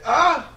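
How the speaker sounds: short, high, loud mock shriek; a hammed-up shocked squeal. Host acts out the accuser with a loud mock shriek. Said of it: "So she said".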